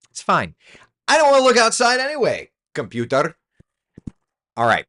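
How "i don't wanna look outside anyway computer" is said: higher, louder, faster mock sulk; sulking at the computer, high and fast; sulky frustration. Real, not acted. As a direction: pretend you didn't want it anyway. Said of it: "now I'm getting frustrated".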